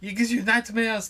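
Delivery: high, hammed-up motherly warning; bad mum impression. Son imitates his mum explaining the cheese ban, high mock warning. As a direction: warn in a badly faked, high mum voice. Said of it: "That's a bad impression of my mum".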